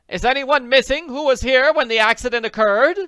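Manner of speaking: loud, high demand; loud high demanding question. A narrator's reading. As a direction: fire it at the guests. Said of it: "she demanded".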